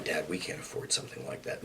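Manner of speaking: soft, breathy-free hushed quote of his daughter; hushed, unvoiced retelling of the daughter; quoting daughter. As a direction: drop to a near whisper for the daughter's line. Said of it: "she'd gone".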